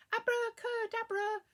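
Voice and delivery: high, loud, quick magic word; shouted spell. A narrator's reading. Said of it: "shouted very quickly".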